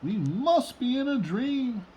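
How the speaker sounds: high, loud amazed cry of the animals; amazed shout. Acted. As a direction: shout it out like the crowd can't believe its eyes. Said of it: "They shouted".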